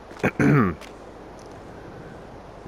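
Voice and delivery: a pointed little throat clear; sarcastic cough. Real, not acted. After bragging about spending, a pointed sarcastic cough. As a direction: clear the throat to underline the joke. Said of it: "that was a cough to".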